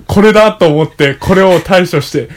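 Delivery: loud, clear reading of a text; reading aloud. Acted. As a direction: read it out like an essay. Reads a passage aloud.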